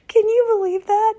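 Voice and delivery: real amazement; amazed. Real, not acted. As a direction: burst out in disbelief at the miracle. Marvels at answered prayer.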